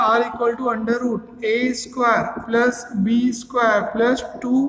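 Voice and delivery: very slow, word by word; slow dictation. Shown as a demonstration. Teacher writing formula for class, very slow dictation. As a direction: write as you speak, slowly.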